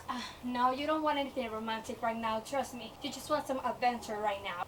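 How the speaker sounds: low, soft voice of her own mind; persuading inner mind. Acted. Mind coaxes reader away from romance, low persuading voice. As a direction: talk yourself out of it, low and quiet. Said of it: "my mind is like".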